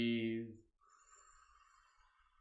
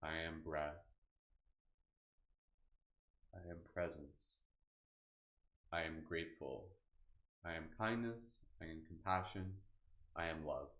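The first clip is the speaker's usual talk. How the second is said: softer, low-key meditation voice; soft guided meditation; 4 semitones lower, 7 dB quieter than usual. Acted. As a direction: let each affirmation settle quietly. Quiet closing affirmations.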